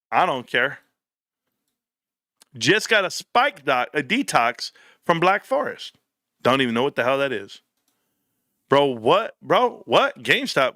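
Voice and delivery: ordinary talk, nothing marked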